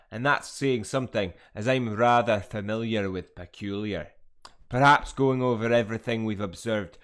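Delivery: put-on loud, hearty character accent; professor voice. Gamer voicing a Hogwarts professor, loud theatrical accent.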